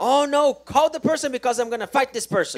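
loud, high mock outburst; angry protest. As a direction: throw his angry objection back at them. Interpreter echoes angry quote. (protesta enojada imitada, fuerte y aguda)

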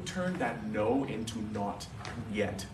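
ordinary delivery

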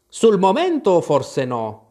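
a whimsical hatter musing; Mad Hatter. Acted. The Hatter's voice, higher and slow. (cappellaio, voce alta e lenta)

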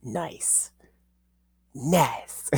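hushed approving. Retold or imitated. Cops approving the hot teacher, soft mock murmur. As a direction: murmur it like impressed guys. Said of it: "everybody just starts saying".

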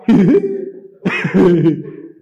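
high, loud mocking laugh; gloating laugh. Preacher as happy enemy watching you cry, loud mocking cackle. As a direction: laugh in triumph at their tears.

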